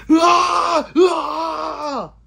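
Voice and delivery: loud high mock battle scream; battle cry scream. Streamer playfully charging into gang territory, screaming a battle cry.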